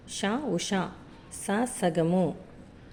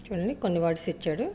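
ordinary talk, nothing marked

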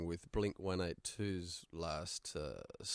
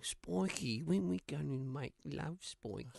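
lightly voiced breathy-free coo of a girlfriend, a bit higher; Spike's girlfriend; 8 semitones higher than usual. Imitating Spike's girlfriend from Angel, a light coo. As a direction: tease it out like a playful girlfriend. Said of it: "Spike's girlfriend saying".

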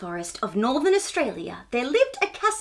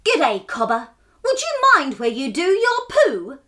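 louder, higher annoyed scolding; irritated shout; 5 semitones higher, 6 dB louder than usual. Acted. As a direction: snap at him, fed up. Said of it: "shouted an irritated trapdoor spider".

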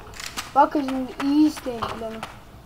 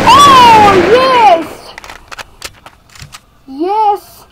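loud, much higher victory shout; triumphant cheer; 8 semitones higher, 14 dB louder, much slower than usual. Real, not acted. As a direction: celebrate the win out loud. Winning the round. (zafer sevinciyle coşkulu bağırış)

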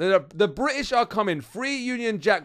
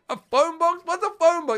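put-on higher, baffled young attendant voice; a confused shop worker who's never heard of phone boxes; puzzled attendant; 8 semitones higher than usual. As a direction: play the clueless attendant, pitched up. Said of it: "He's like, What".

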